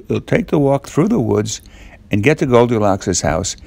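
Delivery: ordinary delivery, nothing marked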